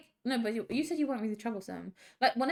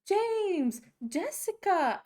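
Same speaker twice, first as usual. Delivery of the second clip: higher drawn-out call of the names; parents' friends calling out; 8 semitones higher, much slower than usual. Retold or imitated. Parents' friends summoning a brother and sister, calling out high. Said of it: "they're always like".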